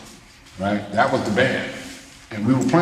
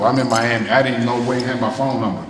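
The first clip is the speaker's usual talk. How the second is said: ordinary delivery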